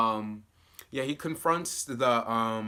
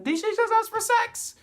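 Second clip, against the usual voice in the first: much higher and louder squeal of disbelief; shocked disbelief; 5 dB louder than usual. Retold or imitated. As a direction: react as if you can't believe your ears. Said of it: "And I'm like".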